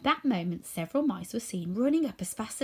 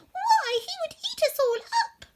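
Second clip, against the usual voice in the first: much higher and louder, panicky; frightened squeak; 5 dB louder than usual. A narrator's reading. Mouse queen dreading the lion, high panicky squeak. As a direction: gasp it out in terror.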